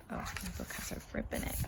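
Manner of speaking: breathy hushed aside; whisper. Real, not acted. Reader noticing a rip in the book, whispering. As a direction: whisper it to yourself.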